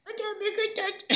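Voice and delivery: tiny, very high, soft squeak; [name]'s Wilson voice. Acted. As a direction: puppet attempting a teeny character voice. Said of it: "cómo va a ser la voz de Wilson, [name]". (vocecita muy aguda y suave)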